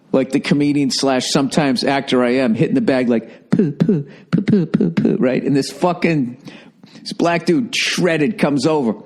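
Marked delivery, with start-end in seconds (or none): 3.5-5.2 punch noises. Retold or imitated. Imitates punching the heavy bag.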